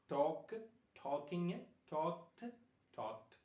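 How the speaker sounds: "ടോക്ക്ഡ്" ending in a hard t, like tokt; correct t ending. Shown as a demonstration. Teacher modelling verb forms for students, "ടോക്ക്ഡ്" ending in t. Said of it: "അങ്ങനെ ആയിരിക്കണം pronounce ചെയ്യാനായിട്ട്".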